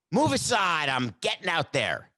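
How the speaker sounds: commanding, starting high and dropping; emphatic command. Acted. Player pushing past security to the field, emphatic. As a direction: order them aside, jumping high on the first words. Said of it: "That could be emphatic".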